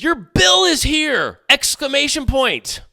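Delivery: high, drawn-out, overexcited announcement; mock excitement. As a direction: announce it like thrilling news, hammed-up. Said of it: "supposed to be excited".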